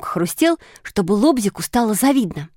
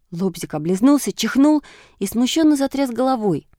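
ordinary delivery, nothing marked

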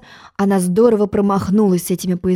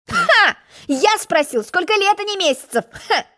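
very high mocking "Ха!" that fades; a smug egg scoffing at the girl; scornful laughing; 11 semitones higher than usual. A narrator's reading. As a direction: laugh her answer off. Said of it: "рассмеялся".

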